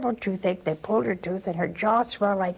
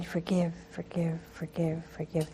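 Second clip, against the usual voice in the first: fading into a soft, breathless whisper; whispered forgive; 3 semitones lower, 10 dB quieter than usual. Retold or imitated. Nun echoes the Lord's plea to forgive, in a softening whisper. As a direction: let each forgive sink to a whisper. Echoing the Lord's repeated command.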